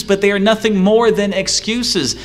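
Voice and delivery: ordinary delivery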